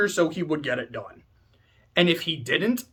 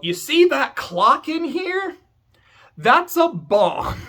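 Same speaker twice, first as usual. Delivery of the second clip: higher, slow, teasing reveal; gleeful villain reveal; 9 semitones higher, slower than usual. Retold or imitated. Beatrice tells the adults about the clock, slow and gleeful. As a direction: savour the reveal like a smug villain. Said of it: "She's like".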